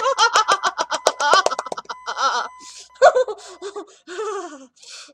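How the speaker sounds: long shaky laugh of pure relief; relieved laughter. Real, not acted. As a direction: laugh it all out, half crying. After surviving the night.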